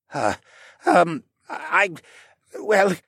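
high, halting hesitation with gaps; trailing off. A narrator's reading. Assassin has no answer for the interrogator, trailing off. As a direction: falter and stall for an excuse. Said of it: "Dolian trailed off".

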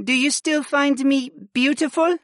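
high female voice, unsure question; hesitant wife. A narrator's reading. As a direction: ask for reassurance in a high voice. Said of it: "she asked quietly".

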